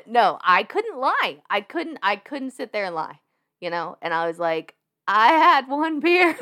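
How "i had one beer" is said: much higher and louder, slow, giggling; laughing fake innocence. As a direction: confess it with a laugh in the voice. Admits drinking to cop, laughing.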